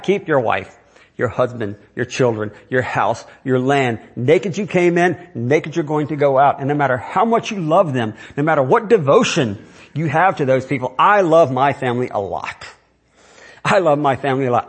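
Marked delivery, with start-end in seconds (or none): none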